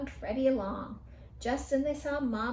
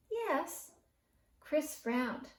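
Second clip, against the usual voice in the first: ordinary delivery, nothing marked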